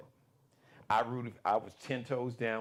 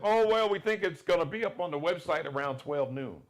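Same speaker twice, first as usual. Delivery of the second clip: mock casual reply; 5 semitones higher, 4 dB louder than usual. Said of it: "And I said".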